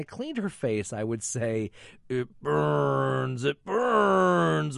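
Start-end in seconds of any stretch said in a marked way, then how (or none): 2.1-4.8 rising demonic wail, louder on the second; possessed girl. Retold or imitated. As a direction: channel the Exorcist girl. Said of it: "Doing my best Mercedes McCambridge impression".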